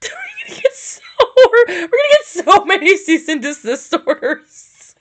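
high laughing voice, cracking with laughter; can barely get it out for laughing. Real, not acted. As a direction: laugh through every word. Laughing hard through words.

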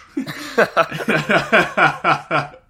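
high, loud rolling laugh; long helpless burst of laughter; laughing fit. Real, not acted. Cracking up at Borat bit.

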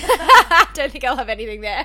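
laughing through the words. Real, not acted. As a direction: laugh it out loudly. Laughing about Perth.

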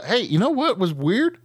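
ordinary talk

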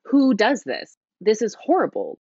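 retold self-reproach. Speaker retells scolding herself over the affair, mildly appalled self-reproach. Voicing own horrified thoughts back then.

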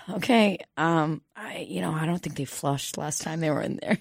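quiet ominous confession; grim hushed warning. Retold or imitated. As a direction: let it out with a weary sigh. Employee grimly warns about the bathroom.

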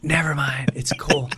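near-whispered, awkward backing out; hushed, embarrassed brush-off; retold embarrassed retreat. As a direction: back out softly, under your breath.

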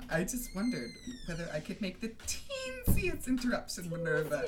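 high, simpering interruption; affected, falsely sweet request; Umbridge. Acted.

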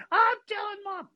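put-on high squealing tattletale kid; shrieky falsetto child voice; screaming. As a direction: imitate a little brother wailing a threat. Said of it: "and he starts screaming".

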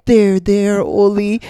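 high, slow, sugary consoling voice; mock comforting. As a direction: pat a fake-crying kid on the head. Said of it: "she goes".

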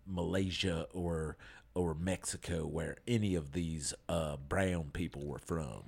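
low, softening apologist voice; put-on character voice. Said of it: "You keep doing that with the, with the voice thing".

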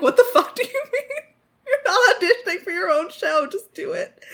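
squeaky mock outrage; mock exasperated director. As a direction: play the incredulous director. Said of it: "and he was like".

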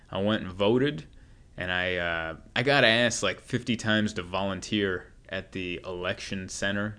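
normal talking voice

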